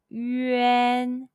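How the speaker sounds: "yuan" drawn out slowly with spread lips on the N; "yuan" very slow, lips puckered then spread wide; slow exaggerated yuan. Shown as a demonstration. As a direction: stretch "yuan" and smile through the N. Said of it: "over-exaggerate the N with spread lips like a smile".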